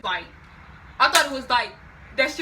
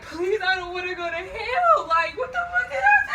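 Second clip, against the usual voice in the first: very high, loud mock wail; over-the-top panicked begging, climbing into a shriek; hammed-up pleading; 9 semitones higher, 8 dB louder than usual. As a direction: squeal a desperate plea to God, half laughing. Acts out pleading with God.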